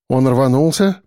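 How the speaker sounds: ordinary delivery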